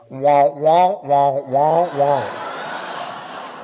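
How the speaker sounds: loud droning nonsense in someone's place; mock unintelligible speaker. Newcomer mimics the meeting speaker he can't understand, loud mock droning. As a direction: imitate speech heard as meaningless noise. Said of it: "I can't even understand that guy".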